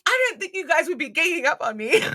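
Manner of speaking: high, loud acted complaint; hammed-up objection, pitched high; mock protest. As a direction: protest loudly as the outnumbered patient. Acted protest at being ganged up.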